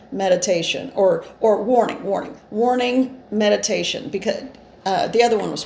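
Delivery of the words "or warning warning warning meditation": normal talking voice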